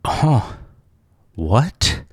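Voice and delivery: retold shock at her answer; incredulous huh. Hearing the shopper's reply, replaying his incredulous huh. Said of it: "And I was mad".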